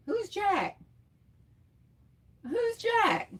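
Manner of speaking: high, puzzled question; puzzled surprise. Real, not acted. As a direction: ask it high, truly baffled. Puzzled at an unknown name.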